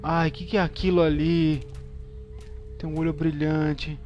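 loud startled alarm; nervous at something in the dark; scared horror game streamer. Real, not acted. Spots glowing eye.

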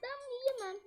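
high, pleading refusal; high, whiny protest of disgust; horrified refusal. Real, not acted.

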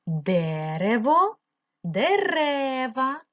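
Дерево, дерева very slow, first е stretched; slow teacher demo stressing the first syllable of Дерево, дерева; stressed check words. Shown as a demonstration. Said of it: "Підбираємо споріднені слова".

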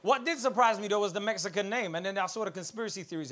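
normal talking voice

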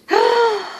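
high gasp of amazement; sharp, high intake of breath; amazed gasp. Real, not acted. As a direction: gasp on opening a present. Gasp on opening the gift.

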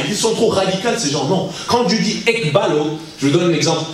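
ordinary talk

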